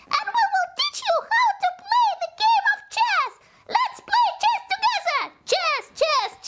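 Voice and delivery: high, fast cheerful sign-off; excited. Acted. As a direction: cheer it out to the kids.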